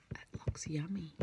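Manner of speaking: soft secret aside; whispered aside. Acted. Teacher telling the class a secret about the book. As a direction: lean in and whisper it.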